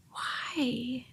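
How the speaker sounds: breathless whispered why; overwhelmed whisper. Retold or imitated. Seeing the line outside, a breathless overwhelmed whisper. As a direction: let it out on a breath, barely voiced.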